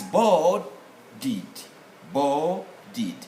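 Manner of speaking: "boarded" broken into BOR ... did; syllable by syllable. Shown as a demonstration. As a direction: slowly separate the two parts of "boarded". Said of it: "look at the syllabic part".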